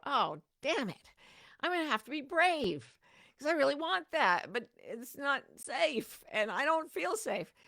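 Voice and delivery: a mock groan that turns eager; mock reluctant excitement. Preacher acting out a tempting offer, mock eager grumble. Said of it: "I would go".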